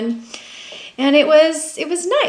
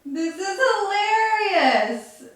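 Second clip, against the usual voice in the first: laughing; 8 semitones higher, 4 dB quieter, much slower than usual. Real, not acted. Laughing at dog photo.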